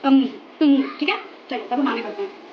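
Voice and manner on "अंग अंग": अंग repeated loudly with a ringing ng ending; teacher drilling अंग; sound demo. Shown as a demonstration. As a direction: model अंग twice with a nasal ng close. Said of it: "ये अंग जैसे इसका word निकलता है".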